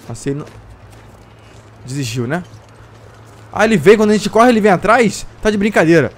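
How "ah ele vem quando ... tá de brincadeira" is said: loud, high panicked yell; being chased. Real, not acted. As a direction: yell in panic while fleeing. Wolf chasing him while running.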